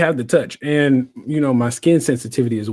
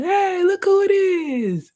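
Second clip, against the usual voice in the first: high, drawn-out crowd cheer; big singsong welcome, way up high; hyped greeting; much slower than usual. Retold or imitated. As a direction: imitate friends hailing a favorite arrival, stretched out. Acted crowd greeting as he comes in.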